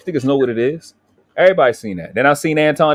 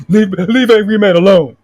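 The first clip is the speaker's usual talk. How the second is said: loud, higher mock protest, drawn out; mock indignant demand; 7 semitones higher, 8 dB louder than usual. Quoting a grown man telling me off, mock indignant. Acting out another man's demand.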